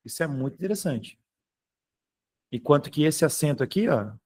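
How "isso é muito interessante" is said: softer echo of the line; quiet repeat. Shown as a demonstration. Teacher repeats the point to the class, quietly. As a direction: say it again, much softer, to yourself.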